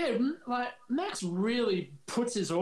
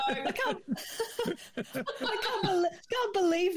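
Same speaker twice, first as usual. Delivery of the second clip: laughing; 7 semitones higher than usual. Real, not acted. Disbelieving laughter at the story.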